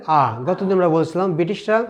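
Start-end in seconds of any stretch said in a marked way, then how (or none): 0.1-0.4 low British "aa" with the r silent; British accent R. Shown as a demonstration. Teacher answers what the British say, British open "aa". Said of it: "ব্রিটিশরা only কি বলে?".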